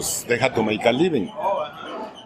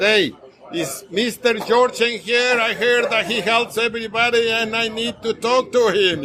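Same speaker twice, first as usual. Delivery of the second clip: higher, half-laughing voice of a pleading lady; imitating the blind lady; 8 semitones higher than usual. Storyteller voicing the blind lady asking for Mister George, higher and laughing. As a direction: play the hopeful lady asking for help, pitched up. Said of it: "she's right over here and said".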